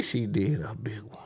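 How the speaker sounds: hushed secret whisper; breathy hush. Retold or imitated. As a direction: whisper it like gossip. Recalling a wild night.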